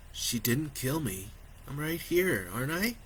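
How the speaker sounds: soft, gentle father; reassuring. A narrator's reading. Father calming his son, soft reassurance. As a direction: reassure him quietly.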